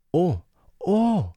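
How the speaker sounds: sehr hohes, gedehntes Kinder-Oh-oh; stöhnender kleiner Bruder. Acted. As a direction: erschrocken aufstöhnen wie ein kleiner Junge. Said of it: "stöhnte er". (hohes, erschrockenes Stöhnen)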